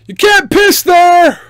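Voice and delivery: high, slow, loud holler; obnoxious shout. Retold or imitated. Yelling at drunk men from the car, obnoxious holler. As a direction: yell it out to bug the drunk guys. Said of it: "just being obnoxious".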